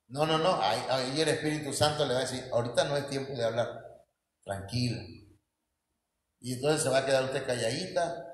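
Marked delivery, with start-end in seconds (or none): 2.5-5.0 voz baja y serena que aconseja; calma tranquilizadora. Acted. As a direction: calma con suavidad, bajando el tono. Holy Spirit calming the wife. (voz serena y tranquilizadora)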